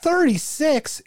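high, slow mock disbelief; hammed-up outraged boyfriend; shocked boyfriend. Retelling Clerks, the boyfriend reels at her number in hammed-up shock.

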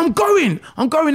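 high, loud indignant snap; huffy retort. Retold or imitated. Patient storming out on the physio, indignant and loud. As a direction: throw it back at her, offended and leaving.

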